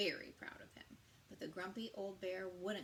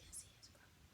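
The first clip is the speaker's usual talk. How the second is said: a whispered little aside; 16 dB quieter, faster than usual. Real, not acted. Teacher whispering to listeners during the story. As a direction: breathe it like a secret.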